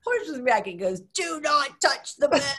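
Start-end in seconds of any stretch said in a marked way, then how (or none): 1.1-2.6 slow, higher, clipped warning; stern guard. Retold or imitated. Palace guard warning an ambassador, slow and stern. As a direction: spell out each word like a stiff guard.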